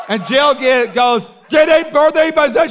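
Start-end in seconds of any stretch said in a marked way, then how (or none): 1.5-2.7 high, loud shouted order; mock command shout. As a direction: bark it to the whole room. Prophetess commanding crowd.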